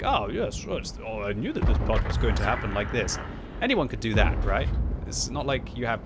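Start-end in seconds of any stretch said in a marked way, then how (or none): 0.0-3.2 smug know-it-all. Retold or imitated. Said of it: "I just tell you, like".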